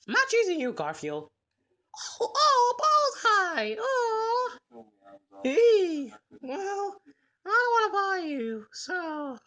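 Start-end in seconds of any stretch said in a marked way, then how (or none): none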